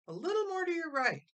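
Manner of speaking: bossing her brothers about the tree; scolding big sister. A narrator's reading. Ada bossing the boys with the tree, a high loud scold. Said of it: "Ada scolded".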